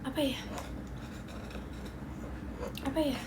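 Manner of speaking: soft whispered musing. Real, not acted. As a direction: wonder aloud in a whisper.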